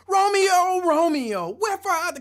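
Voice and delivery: loud, high theatrical declaiming; hammed-up Shakespearean shout; projected stage voice. Shown as a demonstration. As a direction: project to the back row like a stage actor. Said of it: "They gotta project".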